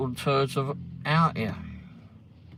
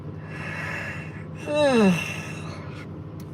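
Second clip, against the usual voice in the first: a long, breathy sigh of real fatigue, no words; long tired sigh; 13 semitones higher, 3 dB louder than usual. Real, not acted. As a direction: let out a long weary sigh. Tired streamer sighs mid-sentence.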